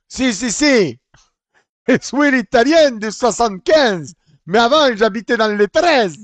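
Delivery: loud, high fake Italian accent in French; hammed-up Italian accent; mock Italian accent. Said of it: "Il a un mix d'accent".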